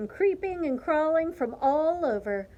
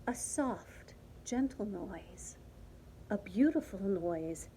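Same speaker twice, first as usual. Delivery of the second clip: hushed, lower narration; soft, gentle; 4 semitones lower, 8 dB quieter than usual. A narrator's reading. Narrator describing the long-sought gentle noise, hushed.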